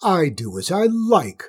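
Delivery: imperious outburst, short of a full scream; screaming queen. A narrator's reading. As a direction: insist on royal rights, loudly. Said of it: "screamed the queen".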